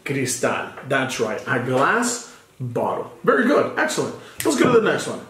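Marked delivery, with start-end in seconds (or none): 1.5-3.1 slow, clear teacher repeat; careful slow demo; slow model phrase. Shown as a demonstration. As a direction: model it slowly again. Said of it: "teacher repeats model phrase".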